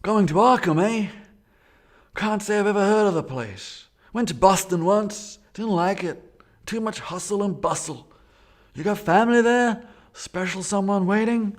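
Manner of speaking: high, loud New England driver voice; a rural New England driver apologizing; New England accent. Acted. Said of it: "It's a New England accent".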